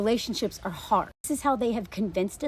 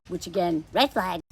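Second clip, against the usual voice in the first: usual speaking voice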